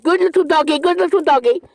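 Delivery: patronizing pet talk; mock praise to a dog. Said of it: "sit like".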